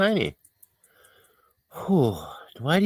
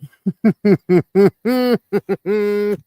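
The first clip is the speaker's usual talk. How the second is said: high laughter, louder; more laughter at the inside joke; laughing; 10 semitones higher, 5 dB louder than usual. Real, not acted. Laughing at own reference.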